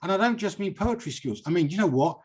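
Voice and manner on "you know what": usual speaking voice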